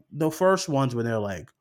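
ordinary talk

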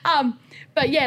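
high, loud giggly hedging; laughing nervously. Real, not acted. To the listener about doctors, laughing nervously. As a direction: laugh it off while backing away.

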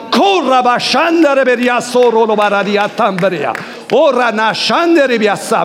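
high, loud ecstatic tongues prayer; fervent glossolalia mid-prayer; speaking in tongues. Real, not acted. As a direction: pour out tongues at full force.

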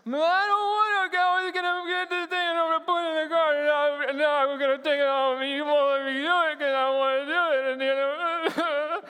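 put-on whining child. Pastor acting a child whining and crying at his parents. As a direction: whine it all in one breath like a kid. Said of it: "it goes something like this".

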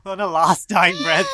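dramatic shout. Acted. GM stresses dying character.

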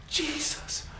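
high, loud with gasps between; overwhelmed with delight; breathless joy. Acted. God announcing his son, breathless high joy.

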